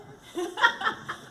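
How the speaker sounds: bright burst of laughter; high delighted laugh. Real, not acted. First question answered, delighted.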